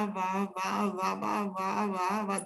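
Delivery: the same sound over and over, softly run together; imitated tongues babbling. Preacher retelling his first time speaking in tongues, imitated babbling. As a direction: softly re-enact helpless, looping babbling. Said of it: "lo único que decía".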